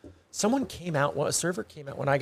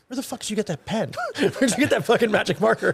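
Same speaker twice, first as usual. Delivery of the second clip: laughing disbelief; 7 semitones higher than usual. Retold or imitated. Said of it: "I'm like".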